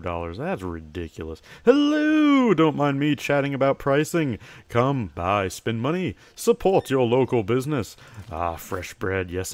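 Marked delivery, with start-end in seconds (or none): 1.6-8.0 high bright sing-song shop greeting, louder; cheery shopkeeper. Acted. Shopkeeper hailing a customer entering the store, cheery and loud. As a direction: welcome customers like an eager shopkeeper.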